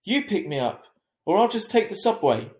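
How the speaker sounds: defiant ultimatum. A narrator's reading. Teen arguing with mother.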